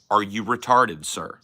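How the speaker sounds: normal talking voice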